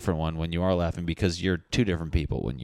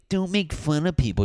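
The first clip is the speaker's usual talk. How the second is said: high, louder mimicry of a scold; mock someone protesting, pitched right up; mock objector; 10 semitones higher, 4 dB louder than usual. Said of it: "if you're like".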